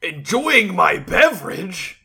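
slow mock-polite question; in-character retort. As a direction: draw it out, a little loud and lilting. Character replies to the GM's challenge.

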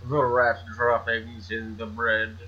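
snooty rich man voice; lightly voiced wealthy snob; rich guy voice. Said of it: "It's my rich guy voice".

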